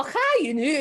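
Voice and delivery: hammy attempt at Scottish; attempted Scottish accent. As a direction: try your stock Scottish accent. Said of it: "my idea of, um, Scottish".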